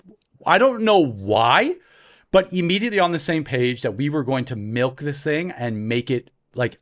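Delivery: usual speaking voice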